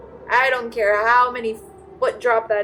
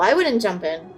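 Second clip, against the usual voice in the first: normal talking voice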